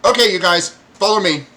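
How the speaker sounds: high, loud kobold voice; grudging little guide; child kobold. Acted. Kobold leads party.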